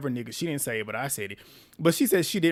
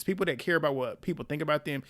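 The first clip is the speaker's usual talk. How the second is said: usual speaking voice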